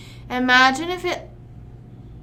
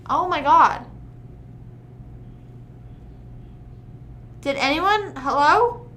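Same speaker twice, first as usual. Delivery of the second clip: alarmed calling out; 3 semitones higher than usual. Real, not acted. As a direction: call out in alarm. Sudden nosebleed, calling out.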